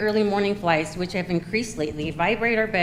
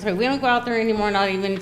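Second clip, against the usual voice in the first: fast and a bit louder; rushed; 3 semitones higher, 5 dB louder, faster than usual. Real, not acted. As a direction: hurry to finish in time. Rushing after over-time warning.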